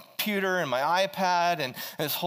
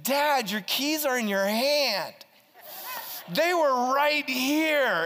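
daughter; 6 semitones higher, slower than usual. Retold or imitated. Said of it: "and she's like".

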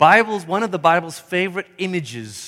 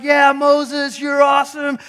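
cheering Israelites; 5 semitones higher than usual. Retold or imitated. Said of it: "and they're like".

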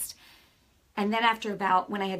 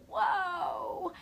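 soft, higher, breathless wonder; awed whoa; 10 semitones higher, 5 dB quieter than usual. Retold or imitated. Hearing the Holy Spirit's answer, a soft higher awed gasp. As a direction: let the amazement slip out.

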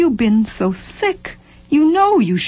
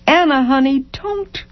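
pleading; 7 semitones higher than usual. A narrator's reading. Husband trying to calm his distraught wife, pleading. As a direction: beg her tenderly to stop.

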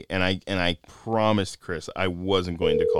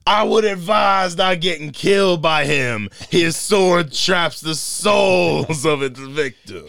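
slow, loud, pitched-up menace; booming trailer voice; 9 semitones higher, 8 dB louder, slower than usual. Pretend. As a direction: drag every word out grandly. Mock trailer voice, Flash bit.

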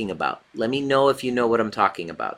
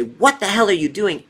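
higher, yelled mock scolding; mock scream at kid; 9 semitones higher, 4 dB louder than usual. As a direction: play the parent screaming at a child. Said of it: "scream at that kid and say".

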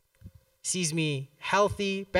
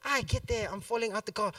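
normal talking voice

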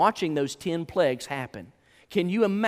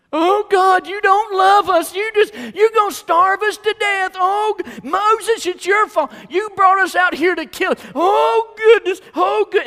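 loud, high hammed-up wail; wailing complaint; 13 dB louder than usual. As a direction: cry out in mock despair, blaming everyone. Acting out starving, complaining Israelites.

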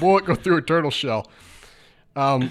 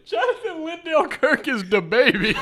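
ordinary delivery

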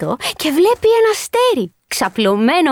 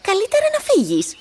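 higher, curt little voice; cold strawberry; 9 semitones higher than usual. Acted. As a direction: shoo the onion away. Strawberry sends onion away. (η φράουλα διώχνει το κρεμμύδι ψυχρά)

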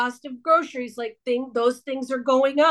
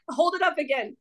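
normal talking voice

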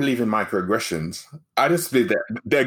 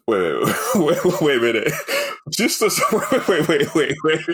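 laughing disbelief. Real, not acted. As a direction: stammer "wait" through laughter. Breaks up laughing, interrupting the story.

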